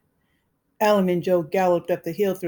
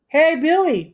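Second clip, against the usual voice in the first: higher, louder, forced hearty call; hearty, quavering call; 10 semitones higher, 8 dB louder than usual. Acted. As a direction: fake cheer over fear. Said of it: "in a hearty, quavering voice".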